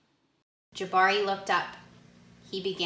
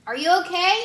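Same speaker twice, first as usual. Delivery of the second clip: louder, higher call up the ladder; 8 semitones higher, 7 dB louder, much faster than usual. Acted. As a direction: call up to him from below. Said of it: "called his dad".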